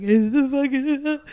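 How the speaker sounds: overwhelmed at the memorial, half laughing; choked-up mock outburst. Telling friends, mimicking herself overcome and near tears. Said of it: "I'll just be like".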